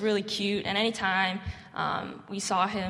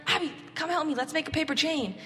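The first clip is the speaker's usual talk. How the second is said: put-on much higher, eager kid voice showing off his craft; little boy voice; 8 semitones higher, faster than usual. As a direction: play the proud little boy calling for attention. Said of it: "Or he'd be like".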